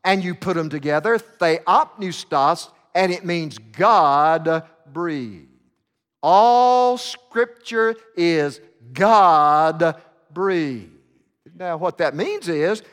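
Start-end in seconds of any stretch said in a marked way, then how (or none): 1.2-2.7 "theopneustos" syllable by syllable; Greek word, slowly. Shown as a demonstration. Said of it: "the Greek word theopneustos". 3.6-5.5 slow, weighty "God breathed"; slow emphasis. Shown as a demonstration. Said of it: "explaining the meaning". 6.2-10.9 very slow, louder "All Scripture is God breathed"; solemn proclamation. Shown as a demonstration. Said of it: "climax of the point".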